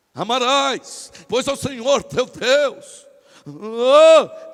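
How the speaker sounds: slow, swelling mock-holy declamation; put-on pious voice. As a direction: stretch each word with exaggerated religious solemnity. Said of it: "quando vai falar com Deus muda de voz". (voz forçada de quem fala com Deus)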